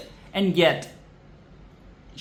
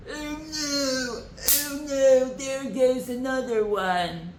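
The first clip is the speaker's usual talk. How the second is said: higher, whiny mock lament; mock royal whine; 8 semitones higher, slower than usual. Mocking the royals watching another worker fall, higher whine. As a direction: ham up the useless royals' dismay.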